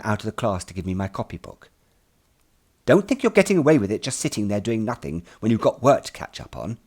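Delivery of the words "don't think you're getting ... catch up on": a hint of a sharp schoolmistress, a bit louder; scolding teacher. Teacher scolds the boy for sitting idle, sharply. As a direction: tick him off like a strict teacher.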